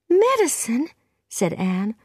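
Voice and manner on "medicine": put-on very high girl's exclamation; shocked. A narrator's reading. Anne learns what she baked in, shocked. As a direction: echo it in high-pitched dismay.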